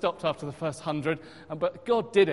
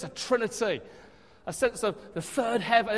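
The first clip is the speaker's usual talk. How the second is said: usual speaking voice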